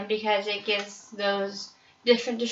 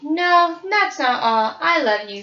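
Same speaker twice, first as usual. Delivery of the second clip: mother bear voice; 6 semitones higher, 6 dB louder than usual. Acted. Mother bear voiced in read story.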